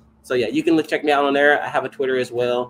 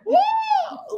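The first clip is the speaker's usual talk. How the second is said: whoop. Real, not acted. Exclaims in excitement.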